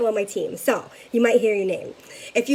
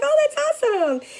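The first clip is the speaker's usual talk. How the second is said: a much higher, gushing squeal; thrilled to hear the bank loves scents. Retold or imitated. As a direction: squeal with delight. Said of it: "I was like".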